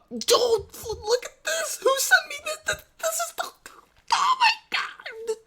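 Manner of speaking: high-pitched squealing disbelief, slowing at the end; excited squeal. Real, not acted. Streamer sees a video viewers sent him, high excited squeal. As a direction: shriek it like you can't believe what you see.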